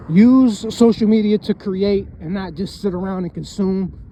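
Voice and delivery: ordinary delivery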